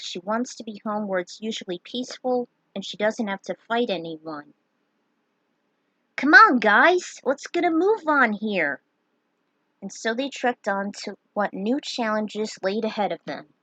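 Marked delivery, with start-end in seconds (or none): 6.2-8.8 rally everyone to move on; calling out. Acted. Leader calls the party to move on, loud. Urging the group onward.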